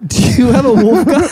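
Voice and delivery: squeaky laughing question; laughing high voice. Pretend. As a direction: laugh through the line high. Echoing the wolf guy joke.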